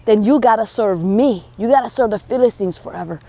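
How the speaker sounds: low, level threat as the giant; mocking giant. Giant setting terms for Israel's army, low and menacing. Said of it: "he says".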